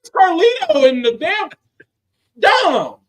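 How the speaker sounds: high-pitched exasperated outcry; hammed-up bewildered protest; mock indignant disbelief. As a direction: complain in a high, put-upon voice. Acting out shock at Carlito walking in.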